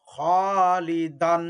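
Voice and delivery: slow, careful model of খলিদান; teacher stretches the heavy alif; heavy drawn-out kha. Shown as a demonstration. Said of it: "মোটা করে পড়তে হবে আলিফকে".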